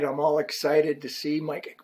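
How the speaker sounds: normal talking voice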